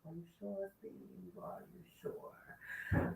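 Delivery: whispered doubting question; skeptical whisper. Real, not acted. Host whispering doubt at Steve's claim. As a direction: breathe it out, disbelieving.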